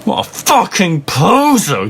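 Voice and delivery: hammed-up angry yell; angry shout. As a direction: spit it out at the poser in mock fury. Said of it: "Draco shouted angrily".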